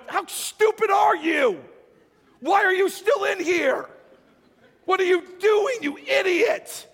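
high, loud, scolding yell; berating shout. Acted. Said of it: "They're not going".

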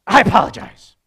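shouted, resentful apology; loud grudging apology through anger; angry yelled apology. Retold or imitated. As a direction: spit out the apology while still furious. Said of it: "Like they, they yell, they're mad".

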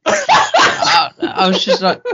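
high, loud laughter breaking into words; laughing. Real, not acted. Bursts out laughing mid-sentence.

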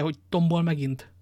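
usual speaking voice

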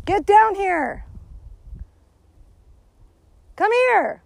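loud, high call to a far-off dog. Real, not acted. Owner shouting for the dog to come back. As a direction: yell across the distance.

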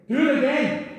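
quoting Alex boldly; not begging. Retold or imitated. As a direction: tell God to do it again, no pleading. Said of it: "I didn't hear begging in that voice".

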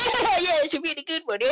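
put-on high, jeering kid voices; mocking little halfling gang. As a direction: egg on the leader like cheeky children. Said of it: "kids around him are just like".

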